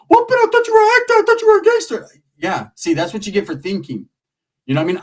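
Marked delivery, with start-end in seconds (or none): 0.1-2.1 high, loud disbelieving voice; shocked cousin. Retold or imitated. Cousin shocked he won't fight. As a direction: act stunned and let down. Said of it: "And my primo".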